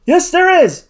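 high, loud, eager call; loud, high-pitched contradiction. A narrator's reading. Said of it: "called Joe".

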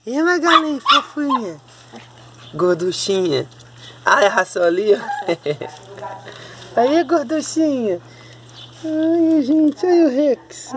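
high, sing-song cooing at the dogs; sweet high pet voice. Real, not acted.

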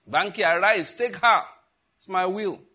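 loud calling voice of God; God calling Banky to take his wife, booming; commanding divine call. Acted. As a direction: call out like the Lord summoning.

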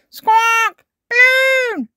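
very high, loud parrot screech; parrot squawk. A narrator's reading. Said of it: "says Polly Parrot".

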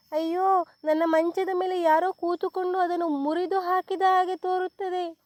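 high, whimpering little bear; baby bear crying over a broken bed. A narrator's reading. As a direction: wail it like a cub about to cry. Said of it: "ಎಂದು ಅತ್ತಿತು".

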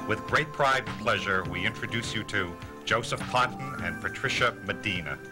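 normal talking voice

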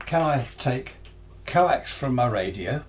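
ordinary talk

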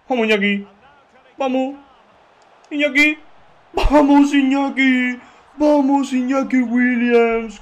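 from an eager call up to a high, loud yell; rising cheer. Real, not acted. Streamer urging his striker on, cheer building to a yell. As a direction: build the chant until the goal comes.

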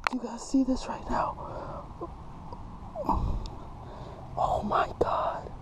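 soft, hushed amazement; awestruck whisper. Real, not acted. Angler letting a fish go quietly, awestruck whisper. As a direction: whisper in disbelief, keeping it down.

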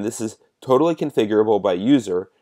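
ordinary talk

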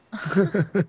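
a burst of high disbelieving laughter. Real, not acted. Laughing in disbelief at the landlord's cheap reply.

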